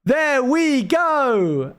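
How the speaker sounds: high, very slow drawn-out catchphrase; imitated AI dog. Retelling the dog's answer after a pause, high drawn-out impression. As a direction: stretch each word high and long. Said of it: "and he goes".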